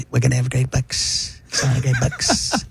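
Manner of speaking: Donald Trump. Acted. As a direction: stretch it out like an understated Trump. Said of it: "I do the library Trump".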